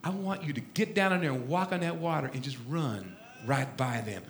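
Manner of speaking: low, very soft fatherly voice; hushed, deep, patient fatherly counsel; God as father. Retold or imitated. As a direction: instruct your son quietly from deep down. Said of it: "He says".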